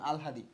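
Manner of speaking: "আলহাদি" low, as al-ha-di; pronunciation demo. Shown as a demonstration. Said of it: "এটা হচ্ছে উচ্চারণ". ("আলহাদি" নিচু স্বরে আল-হা-দি)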